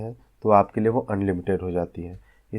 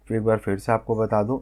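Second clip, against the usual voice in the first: ordinary talk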